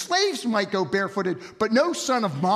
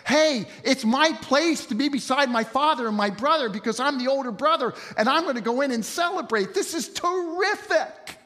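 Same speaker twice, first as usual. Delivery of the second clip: hammed-up rejoicing; mock excited brother; 4 semitones higher than usual. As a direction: gush like a delighted older brother. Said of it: "smiled and rejoiced and was so excited".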